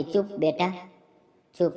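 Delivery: put-on soothing shh to a little girl; soft hushing. Hushing crying child at night. (રડતી દીકરીને ધીમેથી ચૂપ કરાવવી)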